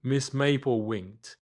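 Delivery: ordinary delivery, nothing marked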